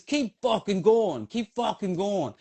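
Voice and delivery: high, slow urging shout down the trail; urgent yell. Retold or imitated. Runner yelling at stopped Canadian runner, urgent high shout. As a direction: yell at him to keep moving. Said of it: "And I says".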